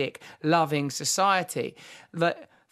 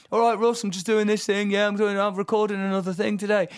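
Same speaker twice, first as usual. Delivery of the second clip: higher, louder cheery mate; cheerful friend; 4 semitones higher, 3 dB louder than usual. Retold or imitated. Friend chatting daily on the phone, cheery and higher. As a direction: breeze through it like nothing's wrong.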